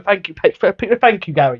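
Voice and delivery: high, stammered mock gratitude; spoiled kid. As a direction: imitate a spoiled kid saying thanks. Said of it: "spoiled kid. You go".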